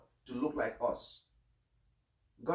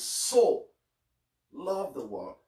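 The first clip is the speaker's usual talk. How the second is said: so hit much higher and louder, then loved the world; stress on so; 3 semitones higher, 7 dB louder, slower than usual. Shown as a demonstration. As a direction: spike the word so. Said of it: "Now, the emphasis".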